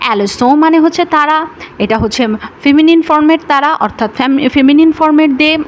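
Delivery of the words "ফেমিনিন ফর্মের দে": ordinary talk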